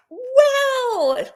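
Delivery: high, drawn-out astonished exclamation; real amazement. Real, not acted. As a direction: let out a high wow of wonder. Amazed at viewer's long marriage.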